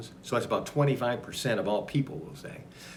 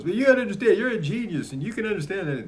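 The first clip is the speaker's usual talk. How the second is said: quoting Oscar; 8 semitones higher, 5 dB louder than usual. Retold or imitated. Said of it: "He goes".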